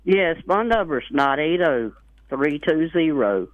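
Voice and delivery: ordinary talk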